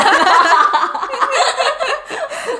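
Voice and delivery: high, loud burst of real laughter. Real, not acted. Laughing hard at her co-host's sorry. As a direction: crack up out loud.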